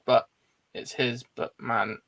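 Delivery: ordinary talk, nothing marked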